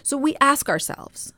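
ordinary talk

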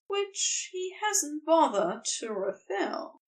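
usual speaking voice

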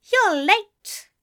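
fussy colleague scolding; huffy. A narrator's reading. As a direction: huff at him for being late. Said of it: "Aziraphale huffed".

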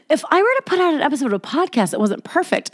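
high, mocking take on a self-important worrier. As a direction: send up your own vanity with a laugh. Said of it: "you think you're so fucking cool".

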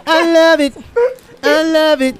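high gleeful mocking voice. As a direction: squeal it like a gloating fan. Mocking the snitch.

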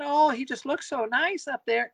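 put-on high, sweet old-lady coo; sweet granny voice. Host mimicking grannies reacting to a band, high sweet coo. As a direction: coo like a doting grandmother. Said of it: "I mean, they talk like that".

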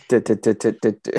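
quick drum roll hits sung out loud; vocal drum roll. Pretend. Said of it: "drum roll, please".

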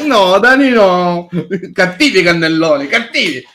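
high voice scolding naughty cannelloni; scolding daddy voice. Pretend. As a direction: tell off a child, high and cutesy. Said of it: "Perché li metto con questo tono?".

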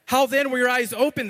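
high, loud demand. A narrator's reading. Neighbors questioning the healed blind man, demanding. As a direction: fire the question out, pitched well up. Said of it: "they demanded".

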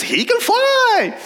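very high, drawn-out falsetto cry; amazed onlookers. Acted. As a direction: imitate a marvelling crowd, slow and squeaky. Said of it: "And everyone says".

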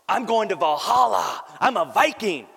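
high, loud proud boast; hammed-up triumphant Viking cry; mock enthusiastic Viking. Dying father boasts of Valhalla, hammed-up and loud. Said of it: "He didn't quite say it with that enthusiasm".

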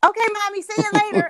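put-on high, loud little-kid voice; imitating a cheery preschool son; carefree preschooler. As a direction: chirp a breezy goodbye like a small child. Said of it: "this dude looks at me and he goes".

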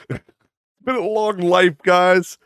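weary lament. Pretend. Exhausted joking lament.

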